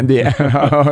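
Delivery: breaking into a laugh mid-word. Real, not acted. Host cracking up at his own hamburger joke, laughing. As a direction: laugh through the words, pitch jumping up. Joking about eating only hamburgers.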